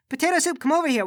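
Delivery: high sing-out to an animal; calling a pet. Acted.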